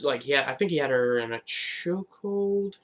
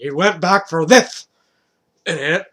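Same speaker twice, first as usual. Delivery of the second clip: louder, slower scoffing voice; mocking viewers; 4 semitones higher, 9 dB louder, slower than usual. Fans yelling at the storyteller, scoffing. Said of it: "I know you guys are like".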